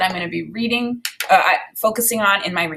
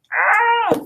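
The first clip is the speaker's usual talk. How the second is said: high delighted squeal; a thrilled little cry; excited squeal; 13 semitones higher than usual. Real, not acted. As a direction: squeal with joy at the new book. Excited about new book.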